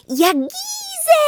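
put-on very high, slow toddler babble; high drawn-out baby word; baby exclaiming. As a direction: shout it like a proud toddler. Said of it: "wykrzyknęła znów Bella".

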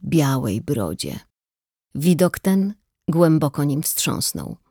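plain reading voice

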